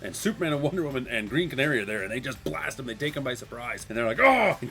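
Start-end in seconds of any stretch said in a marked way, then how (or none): 4.2-4.6 high, loud mock "oh"; surprised yell. Comic narrator voicing surprised heroes, surprised yell. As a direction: blurt a startled cry, playfully.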